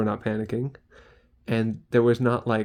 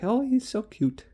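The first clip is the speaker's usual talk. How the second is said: much higher, cooing mock old lady; lightly voiced old resident fussing sweetly; imitating sweet old lady; 11 semitones higher than usual. Resident coos about the cute student, higher. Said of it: "And they're like".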